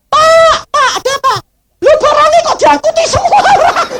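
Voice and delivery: very high, loud panicked mimicry; alarmed maid. Maid alerts boss that goods were carried off, high panicked cry. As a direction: call out shrilly high in mock alarm. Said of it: "pembantunya bangun bilang".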